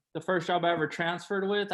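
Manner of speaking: ordinary talk, nothing marked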